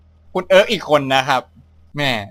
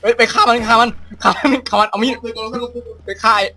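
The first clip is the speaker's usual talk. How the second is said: frantic shouting; 7 semitones higher than usual. Real, not acted.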